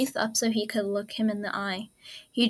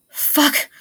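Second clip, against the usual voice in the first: desperate curse; 6 semitones higher, 8 dB louder than usual. Acted. Reviving fails again.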